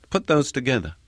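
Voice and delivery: ordinary delivery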